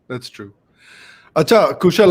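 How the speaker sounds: ordinary delivery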